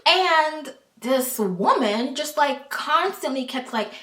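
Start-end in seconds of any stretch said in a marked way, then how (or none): none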